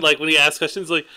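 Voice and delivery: normal talking voice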